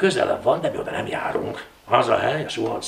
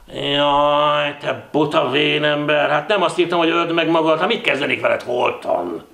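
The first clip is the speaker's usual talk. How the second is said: loud, raised, fond telling-off; exasperated scolding; 4 semitones higher, 4 dB louder than usual. Acted. Ilon to the man who ran himself breathless, exasperated scolding. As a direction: scold the silly old man.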